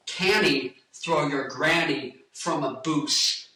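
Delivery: slow, spelled-out Scottish accent. Shown as a demonstration. Showing how relatives in Scotland say it, slow Scottish accent. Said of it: "when I went to Scotland".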